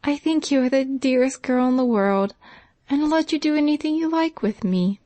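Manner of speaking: a touch of a happy sob; grateful, tearful warmth; laughing through tears. A narrator's reading. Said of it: "with a laugh and sob".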